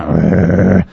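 low, rolling growl; imitated dog growl. Dog outside the tent at night, imitated low growl. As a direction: growl like a watchdog. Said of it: "he's still going".

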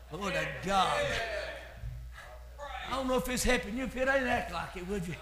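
usual speaking voice

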